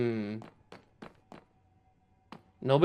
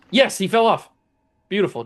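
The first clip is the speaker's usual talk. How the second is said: excited cheer; 6 semitones higher than usual. Real, not acted. Gamer cheering as the enemy knight drops off the ledge, excited. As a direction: celebrate the knight falling off.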